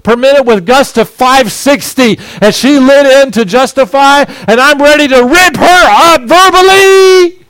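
loud, high preaching at full force; heated shout. Retold or imitated. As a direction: boom it out to the congregation. Preacher shouts angry retelling.

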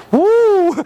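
high, loud "woo"; excited whoop. Retold or imitated. Exclaims at the bargain price.

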